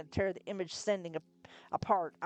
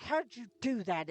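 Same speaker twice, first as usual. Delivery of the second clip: snarling; 5 semitones higher than usual. A narrator's reading. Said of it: "it snarled".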